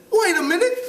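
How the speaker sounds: high, hammed-up British schoolboy; British accent. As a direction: try on a piping British accent. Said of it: "try to do it with a British accent".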